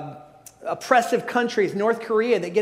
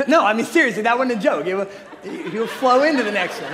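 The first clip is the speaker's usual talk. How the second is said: laughing protest; 3 dB louder than usual. Real, not acted. Insisting amid laughter.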